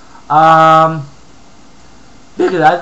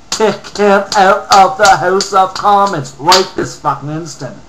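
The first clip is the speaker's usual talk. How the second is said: furious demand; 3 semitones higher, 5 dB louder, slower than usual. Real, not acted. Ranting at a Sikh MP, a loud furious demand. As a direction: demand it with fury.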